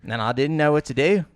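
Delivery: imitated Australian storyteller, every ending lifted; Australian upspeak. Host continuing the survivor's tale, mock Australian upspeak. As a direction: lilt each sentence up in Australian. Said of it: "it's always, like, up".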